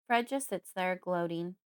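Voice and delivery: ordinary delivery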